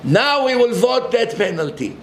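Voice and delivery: high, aggrieved mock whine; mocking lefty complaint. Mocking lefties complaining to the terrorists, in a high aggrieved whine. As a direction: play the betrayed liberal, whining. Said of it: "We hate them just as much".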